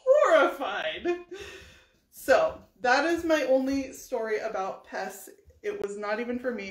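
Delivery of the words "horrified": slow, loud dramatic punch on the word; mock horror. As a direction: draw it out theatrically, half laughing. Dramatic ending to her story.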